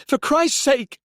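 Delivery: high, loud protest down the phone; calling out urgently. A narrator's reading. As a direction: cry out to stop him. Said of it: "he called".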